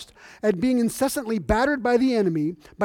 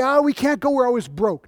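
mock whiny excuse; 4 semitones higher, 6 dB louder than usual. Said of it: "they always say".

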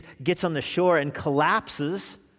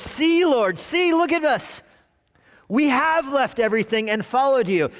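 much higher and louder, pleading for credit; eager Peter; 10 semitones higher, 5 dB louder than usual. Acted. Peter boasts to Jesus that they left everything, high and eager. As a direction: Peter tugging at Jesus, desperate to be noticed.

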